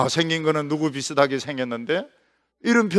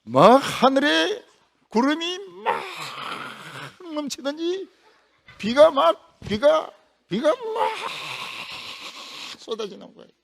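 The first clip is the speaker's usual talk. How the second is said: excited storytelling; 10 semitones higher than usual. A narrator's reading. 비가 쏟아지는 장면 묘사.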